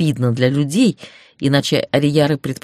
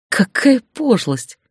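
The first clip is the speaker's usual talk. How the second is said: usual speaking voice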